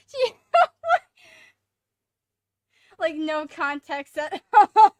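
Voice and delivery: high loud laughing; laughing hard. Real, not acted. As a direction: crack up completely.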